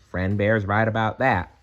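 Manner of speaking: ordinary delivery, nothing marked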